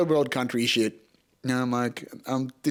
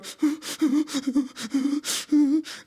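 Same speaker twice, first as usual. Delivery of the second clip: whimpering sob; 10 semitones higher than usual. Retold or imitated. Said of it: "I'm still sitting there going".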